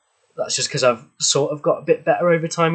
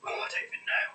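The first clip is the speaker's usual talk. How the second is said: breathy whispered aside to herself; whispering; 12 dB quieter than usual. Real, not acted. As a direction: drop to a whisper, giving up.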